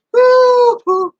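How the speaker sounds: very high, loud whoop of relief; hooting exclamation of relief; big whew. Real, not acted. Relief after telling the story.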